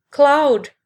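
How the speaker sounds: calling a name out loud, relieved; relieved shout. Acted. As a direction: cry out his name in relief. Friends see Cloud wake up.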